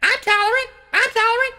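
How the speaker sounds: very high squeaky bird voice; cartoon bird squawk; chirping bird. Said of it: "another bird's like".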